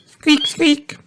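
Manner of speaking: very high squeaks; rabbit squeak. A narrator's reading. Rabbit child delighted about feeding ducks, high squeaking. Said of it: "queers Rebecca Rabbit".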